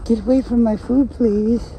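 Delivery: high pleading mock-polite voice; polite dog. Imagined dog asking nicely to leave its food, high and pleading. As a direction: play the dog begging politely, fading off. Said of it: "you think they're saying it nice".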